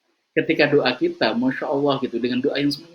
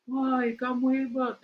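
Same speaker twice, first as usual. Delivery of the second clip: echo; 12 semitones higher, much slower than usual. Acted. Echo returns father's words.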